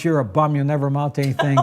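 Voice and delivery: usual speaking voice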